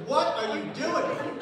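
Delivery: high, loud, drawn-out demand; shocked scolding. Retold or imitated. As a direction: imitate an appalled date. Date scolds him, retold.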